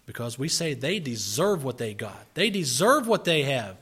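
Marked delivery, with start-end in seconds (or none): 2.3-3.8 loud, high, indignant protest; resentful critic. Pretend. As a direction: insist they got what they deserved.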